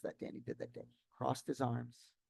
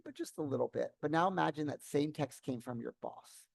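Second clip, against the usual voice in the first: usual speaking voice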